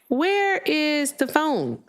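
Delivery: WHERE IS THE PHONE high and very slow; drawn-out searching. Retold or imitated. Host retells hunting for her phone, drawn-out high wondering.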